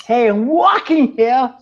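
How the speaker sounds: loud, high New York yell; New York accent. Shown as a demonstration. Pedestrian to a cab driver that almost bumps him, New York shout. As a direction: holler it like an indignant New Yorker. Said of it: "what I came up with is like".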